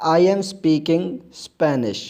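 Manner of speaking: very slow, careful reading for learners; slow model sentence. Shown as a demonstration. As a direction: lay each word down slowly.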